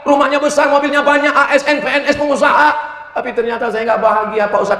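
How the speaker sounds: high-pitched complaining wife. Retold or imitated. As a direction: plead to the preacher as a regretful wife. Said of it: "Pengakuan seorang istri".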